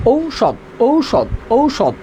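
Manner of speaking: "ঔষধ" slowly parted as ou, then shod; syllable-by-syllable pronunciation. Shown as a demonstration. Teacher answering how it is pronounced, "ঔষধ" slowly as ou-shod. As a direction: repeat "ঔষধ" slowly with a long ou before shod. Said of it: "উচ্চারণ কী হবে?".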